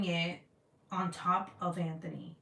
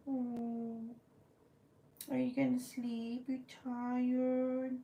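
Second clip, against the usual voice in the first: tender, higher-pitched pet voice; cooing pet talk; 4 semitones higher than usual. Real, not acted. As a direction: coo adoringly at a sleepy pet. Cooing at the pet watching her.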